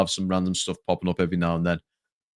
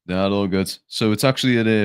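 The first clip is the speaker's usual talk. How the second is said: ordinary talk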